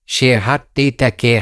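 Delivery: usual speaking voice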